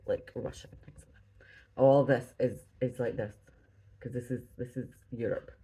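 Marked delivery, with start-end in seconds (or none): 0.1-1.8 whispered aside. Real, not acted. Unsure aside while stitching.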